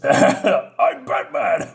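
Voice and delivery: very slow, drawn-out hero announcement; hammed-up superhero voice, stretching each word; Batman. Hero confronts a man in a wrecked car, mock-dramatic. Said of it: "so Batman comes down".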